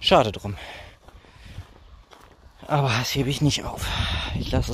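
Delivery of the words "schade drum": usual speaking voice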